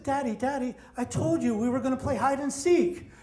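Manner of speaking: a hint of a two-year-old explaining innocently; little girl's voice. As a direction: niece matter-of-factly reminding her dad, lightly childlike. Toddler niece answering her dad.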